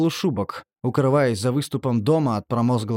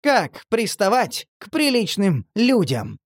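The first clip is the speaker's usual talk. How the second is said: slow, panting angry huff; out of breath; 8 semitones higher, slower than usual. A narrator's reading. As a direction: gasp it out, out of breath. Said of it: "запыхавшийся делец".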